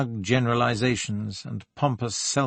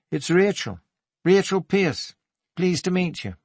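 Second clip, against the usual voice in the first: higher-pitched Geordie lilt; lilting Geordie, slightly husky; 7 semitones higher than usual. A narrator's reading. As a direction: introduce yourself with a lilting Geordie accent. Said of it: "lilting Geordie accent in a slightly husky voice".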